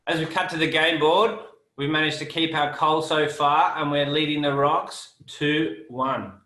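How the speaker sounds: game show host. Acted. Host announces the score.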